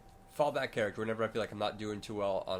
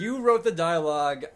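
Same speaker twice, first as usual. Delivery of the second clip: You raised high and loud, stress on wrote; mock concession; 7 semitones higher, 10 dB louder than usual. Shown as a demonstration. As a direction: wrote hit hard in mock giving up. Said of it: "I will take col".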